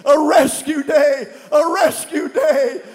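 loud, high fervent prayer; shouted pleading prayer; preaching at full force. Real, not acted. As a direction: pray it out like a revival. Leads congregation in prayer.

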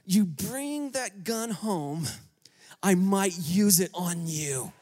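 slow, low, tight-lipped warning; mock a wife threatening through a gripped lip; tight-lipped threat. The wife warns her husband about the gun, slow and tight-lipped. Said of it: "really gripped lip".

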